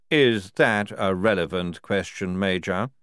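slow, weighty challenge; stern general. Acted. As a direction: draw it out with authority. General challenges major's question.

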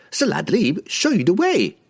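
high, eager merchant voice; fawning, nervous trader. A narrator's reading.

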